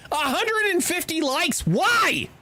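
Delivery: high, disbelieving exclamations; can't believe the numbers; incredulous. Real, not acted. Streamer reacting to his tweet's likes, high incredulous outburst.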